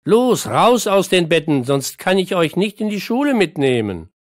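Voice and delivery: loud, high wake-up call; calling out loudly. Acted. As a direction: rouse the kids from bed. Parent rousing children for school.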